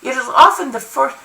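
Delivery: plain reading voice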